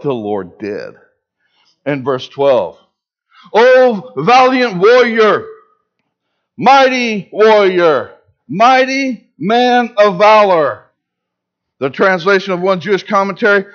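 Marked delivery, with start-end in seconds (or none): 3.5-10.8 slow, loud and majestic, each title rung out; booming divine proclamation. Acted. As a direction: hail him like God calling a hero. God hails hiding Gideon.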